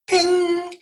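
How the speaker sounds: high, sustained ringing ping; imitated alert ping. Guest imitating the alert that your box has arrived, high ringing ping. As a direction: sing out the word like a chime.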